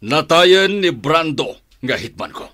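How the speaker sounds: loud declaration. Acted. Father telling his son the hitman is dead, loud and forceful. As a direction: hammer the news home.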